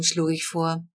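ordinary delivery, nothing marked

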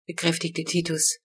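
ordinary delivery

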